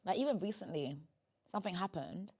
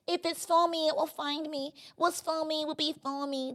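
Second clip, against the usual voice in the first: higher-pitched chilled-out mock quote; passive waiting attitude; 7 semitones higher than usual. Friend mimicking people who wait for their blessings, mock-passive. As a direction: imitate someone just waiting for it. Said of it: "being like".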